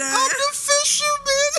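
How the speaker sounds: high, slow joke voice; silly fisherman voice. Pretend. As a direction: squeal it like a cartoon fisherman.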